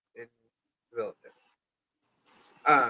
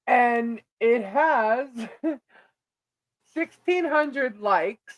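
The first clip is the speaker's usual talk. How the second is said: laughing in disbelief; 5 semitones higher, much slower than usual. Real, not acted. Amazed at viral numbers.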